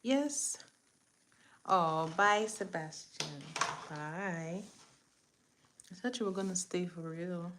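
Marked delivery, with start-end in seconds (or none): none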